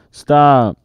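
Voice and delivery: loud, high cry of stop; shout. A narrator's reading. Captain yelling at escaping crew, loud. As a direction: bark it at the runaways. Captain chasing his men.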